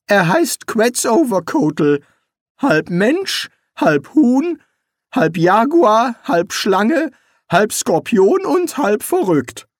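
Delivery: high-pitched wizard listing one by one; Rincewind explaining. Acted. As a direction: draw out each item in a high voice. Voices Rincewind in dialogue.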